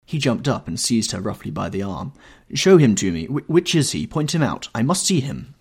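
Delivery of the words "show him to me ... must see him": loud, insistent demands, pitch raised; urgent exclamation. A narrator's reading. Brother demands to see the suitor, exclaiming urgently. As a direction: demand it urgently, starting loud then higher. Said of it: "he exclaimed".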